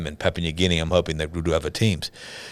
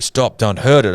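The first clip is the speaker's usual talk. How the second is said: retold urgent plea; 4 semitones higher, 6 dB louder than usual. Retold plea to spare the mother.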